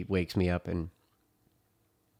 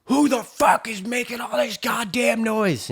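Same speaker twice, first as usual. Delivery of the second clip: mimicked furious yell, high and strained; mad housemate; 4 dB louder, slower than usual. Woken housemate demands who made noise, imitated angry shouting. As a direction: act out an enraged guy yelling. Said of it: "he's madder than fuck".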